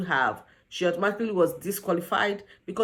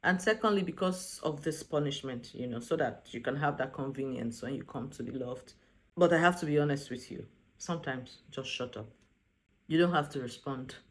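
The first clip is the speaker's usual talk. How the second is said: low, soft, measured imitation; advising a friend; 6 semitones lower, 8 dB quieter than usual. Ricky advising his friend Bells, quiet earnest advice. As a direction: counsel quietly, low and unhurried. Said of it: "Ricky is trying to advise Bells".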